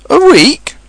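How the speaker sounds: high, loud, dismayed echo; gloomy. A narrator's reading. Pooh learning he waits a week, gloomy. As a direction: exclaim it in gloomy disbelief. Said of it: "said Pooh gloomily".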